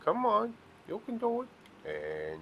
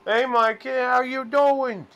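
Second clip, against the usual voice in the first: excited greeting; 12 semitones higher, 6 dB louder than usual. Real, not acted. Greeting a chat viewer loudly.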